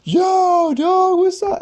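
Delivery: high, loud dude voice; mock bro greeting. Everyone greets him as a big deal, high and loud. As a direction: hype buddy shout-out. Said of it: "everyone was like".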